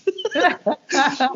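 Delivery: high burst of laughter; laughing. Real, not acted. Telling the crazy story, laughing. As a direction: laugh at the memory. Laugh after retold quote.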